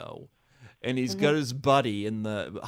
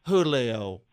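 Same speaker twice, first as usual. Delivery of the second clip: louder, drawn-out mock call; imitated mother; 3 semitones higher, 6 dB louder, slower than usual. Mother spots the suitor outside, mock loud drawn-out call. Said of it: "She goes".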